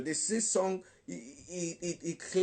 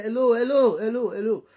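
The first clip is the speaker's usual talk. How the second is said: loud, raised challenge; standing one's ground; 3 semitones higher, 8 dB louder, faster than usual. Acted. Preacher showing how to stand our ground, loud challenge. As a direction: call it out firmly to stop them. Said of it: "stand our ground and say".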